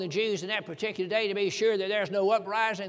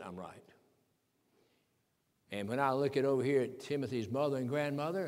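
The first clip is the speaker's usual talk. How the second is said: usual speaking voice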